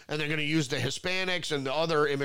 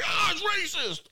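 high, hammed-up shriek of outrage; mock shriek; 14 semitones higher than usual. Mocking a leftist hearing the theory, high outraged shriek. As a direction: squeal the accusation.

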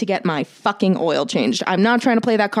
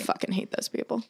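whispered curse; 3 semitones higher, 10 dB quieter than usual. Real, not acted. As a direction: whisper it under the breath. Muttered curse at mechanics.

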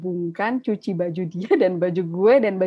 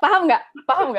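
worked-up plea; 8 semitones higher, 6 dB louder, faster than usual. Real, not acted. Venting, demanding agreement.

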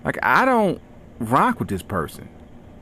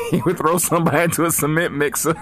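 laughing; 4 semitones higher than usual. Real, not acted. Laughing at own story.

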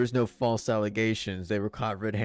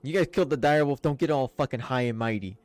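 louder, quicker scolding in the middle; stern rebuke; 4 semitones higher, 6 dB louder than usual. Acted. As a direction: tell them off and dismiss them. Villager rebukes the party.

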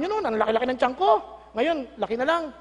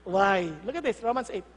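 ordinary talk, nothing marked

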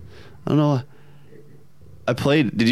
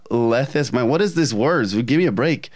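normal talking voice

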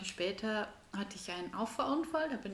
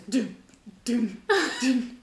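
bouncy thuds, getting louder; fly thumping glass; 3 semitones higher, 8 dB louder, slower than usual. Retold or imitated. Fly bumping against window.

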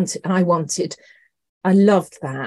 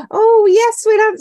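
high, loud eager reply; mock the manager's keen answer; a lively, much higher and louder retold yes; 6 dB louder than usual.

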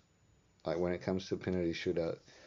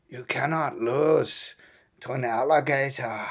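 put-on slow, high, loud Irish accent, stretched out; Irish fan yelling in a thick Irish accent; 6 semitones higher, 6 dB louder than usual. Irish fans urging Conor on, slow loud Irish accent. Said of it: "that's supposed to be my Irish accent".

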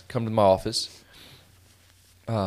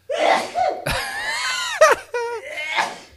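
big burst of shrieking laughter; loud laughing shout; 3 dB louder than usual. Real, not acted. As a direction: howl with laughter. Laughing at burning spray.

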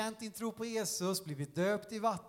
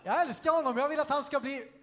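loud, raised, emphatic declaration; defiant affirmation; 4 semitones higher, 4 dB louder than usual. Acted. Dan affirms his love and identity, loud and defiant. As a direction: proclaim it firmly against the doubts.

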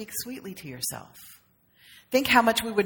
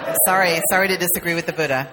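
quick, louder words broken by a laugh; laughing apology; 7 dB louder, faster than usual. Real, not acted. Sorry to disagree with the Buddha, said with a quick laugh.

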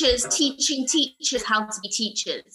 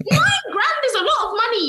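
high, loud outburst of disbelief; emphatic exclamation; 13 semitones higher, 7 dB louder, faster than usual. Real, not acted. Student to friends about tuition debt, loud emphatic exclamation. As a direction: exclaim it loudly, pitched right up.

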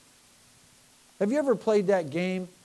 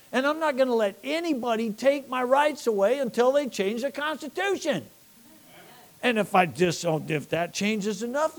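raised, louder preacher's cry; fiery preaching; 8 semitones higher, 4 dB louder than usual. Acted. Preacher rousing the church, full-force sermon. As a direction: preach it hard to the congregation.

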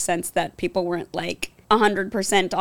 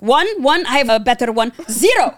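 put-on Arabic accent; 5 semitones higher, 5 dB louder than usual. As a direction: brag in a light Arabic accent. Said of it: "Notice my nuanced accents".

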